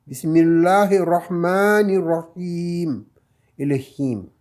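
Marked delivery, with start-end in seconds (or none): 0.1-3.0 Bismillahirrahmanirrahim with ma and him held long; stretched vowels. Shown as a demonstration. Teacher recapping the two stretches, slow recitation. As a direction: hold both long vowels. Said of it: "c'était avec le ma".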